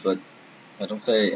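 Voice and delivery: normal talking voice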